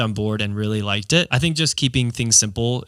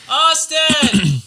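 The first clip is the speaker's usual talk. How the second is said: high, loud call of a name; yelling across the room; 5 dB louder than usual. Acted. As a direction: call him across the office. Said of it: "yelling across the room at somebody".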